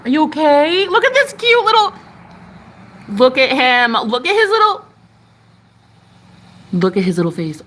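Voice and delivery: high cooing over the little moth. Real, not acted. Cooing at the moth she is letting outside.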